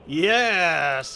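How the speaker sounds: loud, drawn-out cry of victory; triumphant cheer. Real, not acted. Celebrates succeeding in the game.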